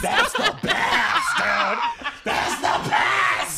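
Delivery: slow drawn-out laughing. Real, not acted. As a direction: stretch it out while cracking up. Laughing hard at story.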